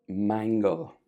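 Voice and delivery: usual speaking voice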